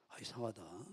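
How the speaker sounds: low, soft puzzled aside; under the breath, low and puzzled. Retold or imitated. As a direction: voice someone wondering quietly to themselves. Voices someone's puzzled aside to self.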